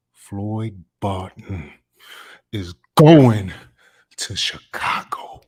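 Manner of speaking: slow, heartfelt plea with sudden loud punches; impassioned dramatic monologue. Acted. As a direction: draw the words out, swelling on key words. Audition monologue performed.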